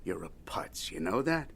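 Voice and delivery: hissed under the breath, barely voiced; whispered insult. [name] whispering an insult at Daniel across the table.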